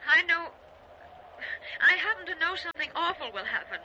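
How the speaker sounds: high, urgent, fraught; anxious warning. Acted. Wife warning her husband of something awful, frightened.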